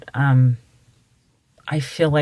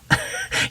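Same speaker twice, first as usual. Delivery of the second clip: soft laugh; 5 dB quieter than usual. Real, not acted. Breathy laugh.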